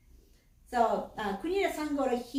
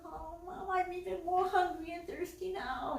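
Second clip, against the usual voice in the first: imitated pleading little boy; begging whine; 9 semitones higher than usual. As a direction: plead with mom like a whiny kid. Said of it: "the little kid kept begging the mom".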